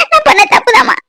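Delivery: high pleading cry with stress on நான்; a daughter confesses her fault to her mother, crying; tearful confession. Acted. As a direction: admit the fault through tears, leaning on நான்.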